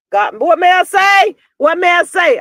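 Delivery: high, loud cry of what may I say; loud, high exclamation; exclaimed to God. Real, not acted. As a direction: throw it up to the heavens. Calling out to God.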